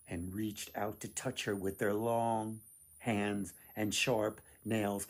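ordinary delivery

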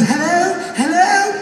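very high British hello, rising; high-pitched puzzled British phone answer; British accent. Retold or imitated.

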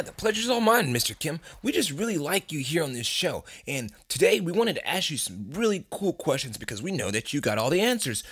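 usual speaking voice

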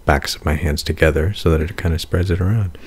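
normal talking voice

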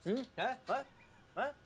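normal talking voice

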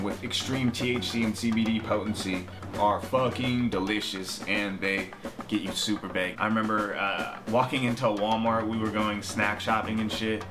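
ordinary talk, nothing marked

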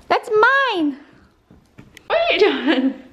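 high playful "That's mine"; playful protest. Real, not acted. Toddler takes her sandwich.

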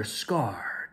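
ordinary delivery, nothing marked

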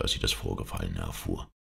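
ordinary delivery, nothing marked

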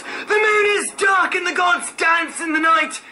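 very high frantic shriek; high-pitched terrified shrieking prophet. Acted. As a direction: shriek it out in the dark, terrified and awed. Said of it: "shrieking in the shadows".

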